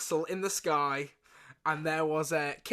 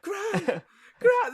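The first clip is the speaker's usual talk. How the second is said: calls climbing much higher and louder; rising alarm; 4 dB louder, much slower than usual. Retold or imitated. Grandson finding his nan still in the armchair, rising panic. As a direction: call gran with growing panic. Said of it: "He'd be like".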